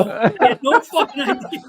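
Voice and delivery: laughing. Real, not acted. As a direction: fight off real laughter as you say it. Retelling his reply while laughing.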